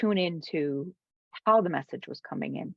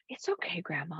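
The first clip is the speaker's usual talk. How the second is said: whispered through tears; comforting grandmother in a whisper; soft whisper; 9 dB quieter than usual. Retold or imitated. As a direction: hush it gently to grandma.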